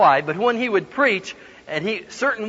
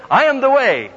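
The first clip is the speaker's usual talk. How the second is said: high, loud proclaiming repeat; boldly belted-out recitation; loud declaration; 8 semitones higher, 6 dB louder than usual. Retold or imitated. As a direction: proclaim the borrowed line to the whole room. Boy loudly repeats whispered prompt.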